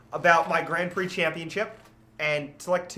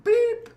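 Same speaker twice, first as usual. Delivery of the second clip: high censor bleep; imitated censor beep; 4 dB louder than usual. Storyteller bleeping out a border officer's swearing, mimicked censor beep.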